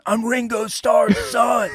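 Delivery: high, drawn-out mock yell; imitated manic holler. Imitating a friend on speed at a festival, manic high holler. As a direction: holler it like a wired friend running wild.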